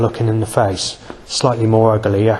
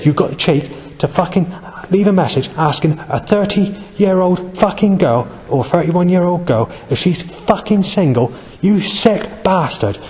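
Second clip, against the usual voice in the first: imitated phone rant, pitched much higher; retold angry shouting; 8 semitones higher than usual. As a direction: mimic her effing and blinding, high and indignant. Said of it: "shouting down, effing and blinding".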